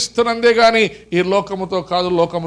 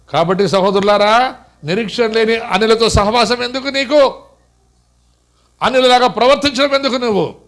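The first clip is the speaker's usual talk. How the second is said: preaching; 4 semitones higher, 4 dB louder than usual. Acted. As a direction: press the brothers hard, word by word. Preacher exhorting the church.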